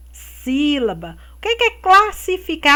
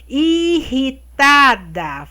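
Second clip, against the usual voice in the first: slow syllable reading; 3 dB louder, much slower than usual. Shown as a demonstration. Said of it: "vocês vão separar".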